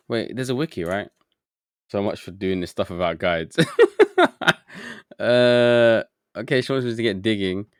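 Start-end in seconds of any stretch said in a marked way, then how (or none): 3.6-5.1 sudden high burst of laughter. Real, not acted. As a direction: laugh out high and loud at yourself. Streamer laughs at own guide-less plan.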